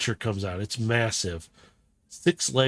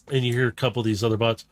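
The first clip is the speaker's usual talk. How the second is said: ordinary delivery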